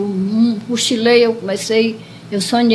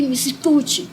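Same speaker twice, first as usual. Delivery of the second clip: ordinary talk, nothing marked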